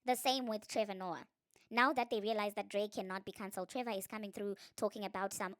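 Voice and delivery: ordinary talk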